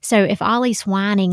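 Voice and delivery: ordinary delivery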